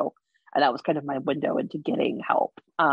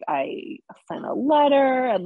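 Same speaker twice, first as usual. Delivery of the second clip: slow, higher, close to tears; voice breaking; 8 semitones higher, 4 dB louder, slower than usual. Real, not acted. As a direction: let the voice crack as tears come. Recalling painful break, voice cracks.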